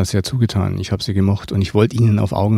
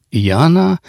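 high, rising, expectant question; prompting, hopeful lift in pitch; 7 semitones higher, 4 dB louder than usual. A narrator's reading. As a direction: ask it expectantly, lifting the pitch sharply. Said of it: "fragte die Frau erwartungsvoll".

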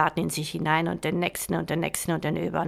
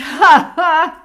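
loud, high mocking guffaw; booming laugh; 11 semitones higher, 13 dB louder than usual. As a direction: laugh like a brute warlord. Warlord laughs.

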